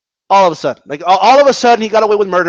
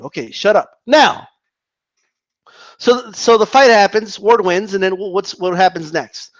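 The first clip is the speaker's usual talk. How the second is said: usual speaking voice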